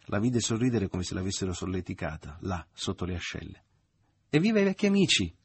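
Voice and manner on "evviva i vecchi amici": much higher, loud reckless cheer; wild cry. A narrator's reading. Mother cheering old friends, loud and high. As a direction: shout it out with abandon. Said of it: "ella gridò perdutamente".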